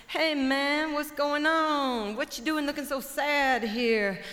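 high, loud, cocky hawk calling out; brash chicken hawk. Acted. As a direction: swagger in as a show-off bird. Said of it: "Chicken hawk says".

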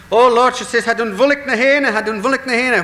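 high, loud, panicked false alarm; alarmed cry. Acted. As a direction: raise the alarm as if the hill is burning. Wife fakes alarm at fire.

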